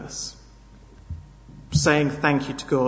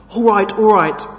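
panting; 4 semitones higher, 8 dB louder than usual. Acted. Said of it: "panted John".

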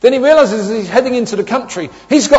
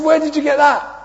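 incredulous; 9 semitones higher than usual. Retold or imitated. Dad asks son, amazed.

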